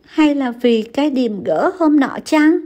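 tearful moan. A narrator's reading. Crying wife asks her husband about a bad omen. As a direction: whimper it through tears. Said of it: "rên rỉ nói với chồng".

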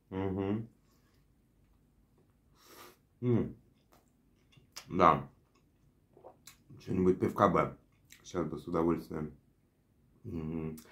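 chewing while talking; speaking between bites; mouth full of burger. Real, not acted. Seen 3: Holds burger from 4.4, bites 10.6–13.1, chews while speaking after.

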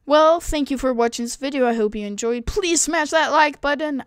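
loud, bubbly YouTuber sign-off; video outro. Pretend. Gamer recording an outro for viewers, loud outro voice. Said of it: "I'm just gonna do my outro now".

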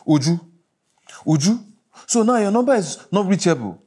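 loud, mounting fury at an absent wife; angry shouting. Acted. Furious phone message to absent wife.

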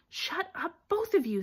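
sharp, impatient command. A narrator's reading. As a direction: cut off the bickering sharply. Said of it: "said Harry sharply".

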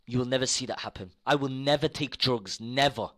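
normal talking voice

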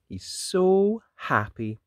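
plain reading voice

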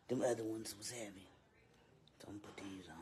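hushed aside; whisper. Real, not acted. Streamer whispering an aside about the glasses. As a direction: whisper it so no one hears.

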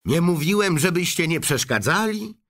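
put-on bratty boy gushing about gangster shows, voice pitched well up; a spoiled kid raving about his favourite serial; high-pitched boy bragging over the TV; high excited boy. TV-obsessed boy Mike Teavee.

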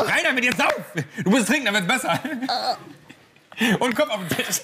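high, laughing commands. Real, not acted. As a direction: urge him on, cracking up. Laughing while urging his partner on.

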